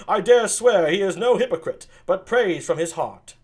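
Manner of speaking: a prince greeting his host, loud and high; Don Pedro. Acted. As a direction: announce it like a prince.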